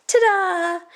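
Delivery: bright, loud, high ta-da; show-off flourish. Acted. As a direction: announce yourself like a star. Grand entrance performance.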